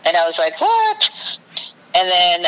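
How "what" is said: high-pitched mock disbelief; incredulous what. Woman retelling her reply to his plea, incredulous.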